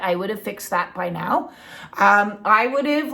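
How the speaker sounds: ordinary talk, nothing marked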